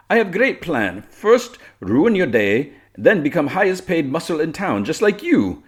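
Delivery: high, loud theatrical villainess; gloating villainess. Acted. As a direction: lay out the scheme with slow relish.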